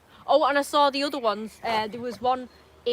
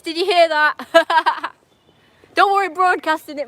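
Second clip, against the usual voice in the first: loud call; 4 semitones higher, 8 dB louder, slower than usual. Real, not acted. Calling loud, laughing.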